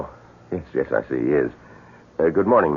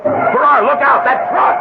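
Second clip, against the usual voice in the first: loud, much higher alarmed yell; warning shout; 7 dB louder than usual. Acted. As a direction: scream the warning. Warning of oncoming truck.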